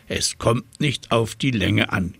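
usual speaking voice

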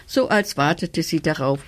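usual speaking voice

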